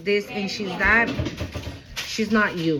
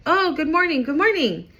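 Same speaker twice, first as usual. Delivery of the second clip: bright, higher sing-song good morning; mock cheerful morning hello; cheerful greeting; 7 semitones higher than usual. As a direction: greet them like you're happy to see them.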